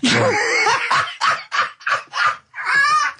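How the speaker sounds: long high burst of laughter; high, helpless laughter; big laugh. Real, not acted. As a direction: crack up at the absurd detail. Karl's goggle-eye monkey story.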